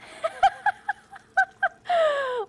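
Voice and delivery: a long, high burst of laughter. Real, not acted. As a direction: laugh out loud at how much booze it is. Laughing at the booze amount.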